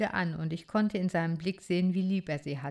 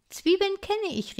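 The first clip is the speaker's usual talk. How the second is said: higher, louder proud little shout; proud call; 14 semitones higher, 4 dB louder than usual. Acted. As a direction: show off what you know. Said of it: "rief ich stolz".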